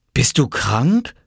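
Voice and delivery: slow, rising question; surprised child. Acted. Boy learns mother is ill, surprised question. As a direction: stretch it out in boyish surprise. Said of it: "fragte Oskar überrascht".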